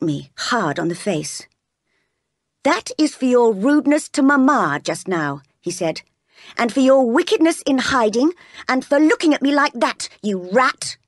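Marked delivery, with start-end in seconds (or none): 2.6-5.4 put-on higher, forceful scolding from a spoiled boy; bullying young master. John berating Jane after hitting her, bullying. 6.6-11.0 high-pitched, forceful accusation; bullying young master. Acted. John accusing Jane of hiding, bullying.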